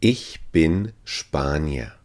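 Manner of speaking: ordinary delivery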